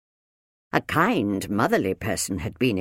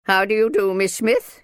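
loud, raised, stiff greeting; formal; 9 semitones higher, 7 dB louder than usual. A narrator's reading. Minder returns Aunt Folly's greeting, formal. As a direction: greet her formally and firmly. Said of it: "I prefer to be formal".

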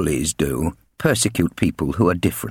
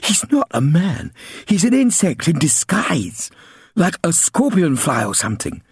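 put-on high, lisping small child; lisping little girl; 11 semitones higher than usual. Little sister questioning the bullies, innocent lisp. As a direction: ask it with a little girl's lisp. Lizzie's voice.

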